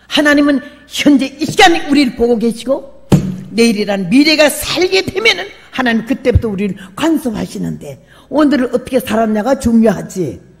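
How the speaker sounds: ordinary talk, nothing marked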